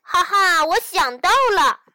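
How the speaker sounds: high, loud, gleeful outburst; excited shout. A narrator's reading. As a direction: burst out with a brilliant idea. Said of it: "兴奋地大叫". (兴奋地高声大叫)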